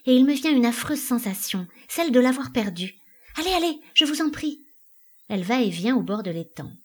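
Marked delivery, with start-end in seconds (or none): 0.1-4.7 raised, distressed urging; anxious pleading. A narrator's reading. Elida begs Arnholm to fetch Wangel, distressed. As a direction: build into a high, pressing plea.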